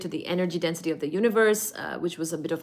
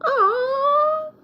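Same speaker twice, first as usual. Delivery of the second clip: mock cry; 3 dB louder than usual. Pretend hurt over Baji.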